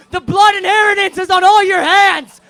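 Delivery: high, loud shouted blame; screamed accusation. Acted. Singer screaming blame at the audience, shouted. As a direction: hurl it at the crowd. Repeated ranted line.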